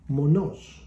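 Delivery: monos with a loud final syllable; stress on second O. Shown as a demonstration. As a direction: say monos as mo-NOS. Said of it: "on the second O".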